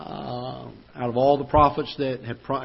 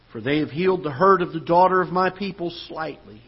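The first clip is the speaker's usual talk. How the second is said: higher, louder pulpit reading voice; fervent scripture reading; 4 semitones higher, 4 dB louder than usual. Acted. Preacher reads Jeremiah's warning aloud to the church, fervent. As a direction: proclaim it like a prophet's lament.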